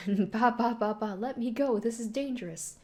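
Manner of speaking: a hint of a flustered heroine; flustered girl. Flustered girl being carried off.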